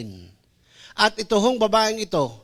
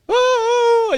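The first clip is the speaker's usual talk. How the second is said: mock cheer; 4 dB louder than usual. Joyful cry at reconciliation.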